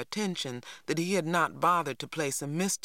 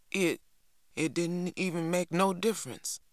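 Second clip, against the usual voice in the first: ordinary delivery, nothing marked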